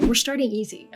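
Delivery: ordinary talk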